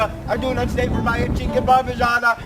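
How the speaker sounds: angry. Acted. Galileo throws them out.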